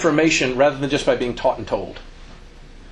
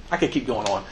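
usual speaking voice

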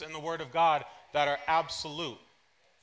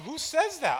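mock yell, pitched higher, laughing; retold yelling; 12 semitones higher, 4 dB louder than usual. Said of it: "And I'm yelling at him".

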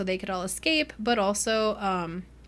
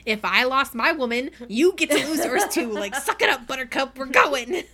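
higher and louder, pushy and gruff; bossy mock yell; 4 semitones higher, 6 dB louder than usual. Wolf hauling Thorn off, in a bossy mock yell. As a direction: bark the orders, playing it big.

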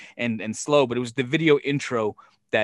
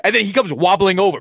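excited; 7 semitones higher, 8 dB louder than usual. Real, not acted. Comic retelling, loud and high.

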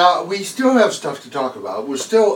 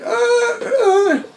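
high squeaky animal cries; squeaking baby squirrel; 8 dB louder, much slower than usual. Retold or imitated.

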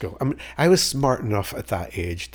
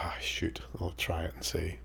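softer, breathy whispered thought to himself; whispered aside; 10 dB quieter than usual. Retold or imitated. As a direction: mutter it under your breath, resigned. Inner thought, resigned.

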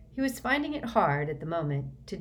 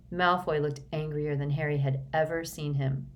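ordinary delivery

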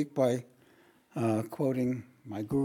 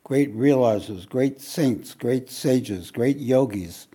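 preaching; 7 dB louder than usual. Acted. Preaching with rising force.

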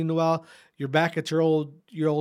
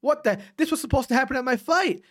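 higher, a bit louder mock outburst of frustration; retold anger; 9 semitones higher, 3 dB louder than usual. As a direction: replay the fury, voice climbing higher. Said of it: "And I got mad again".

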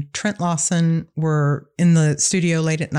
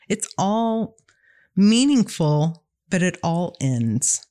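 ordinary talk, nothing marked

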